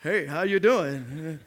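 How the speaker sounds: put-on low, slow elderly greeting; put-on aged voice, drawn out; shaky old man. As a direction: imitate an old man with a slight shake. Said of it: "he would shake a little bit".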